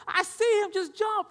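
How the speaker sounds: high, loud, exasperated mother's shout; imitated screaming mom. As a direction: shout up at a balking kid like his mom. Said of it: "She's, like, screaming out there".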